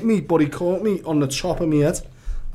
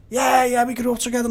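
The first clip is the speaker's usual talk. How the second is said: higher, lightly acted over-familiar claim; insistent stranger; 6 semitones higher than usual. Stranger insisting to a baffled man they grew up together, mildly excited. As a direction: insist you are old friends.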